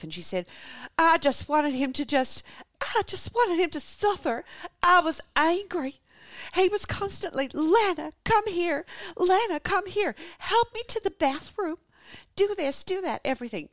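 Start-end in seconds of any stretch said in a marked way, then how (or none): 1.0-6.0 high, slow, loud Southern drawl; hammed-up bitter Southern wife; embittered Southern accent. Wife confessing after dropping the grieving act, embittered Southern accent. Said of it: "into an embittered wife". 6.5-13.7 very high Southern complaint, mimicking his orders; mock his calls in a high, loud Southern voice; embittered Southern accent. Wife imitating husband's constant demands, embittered Southern accent. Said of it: "into an embittered wife".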